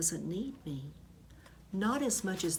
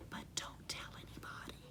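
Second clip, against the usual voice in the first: whisper; 14 dB quieter, faster than usual. A narrator's reading. Secret gift from teacher.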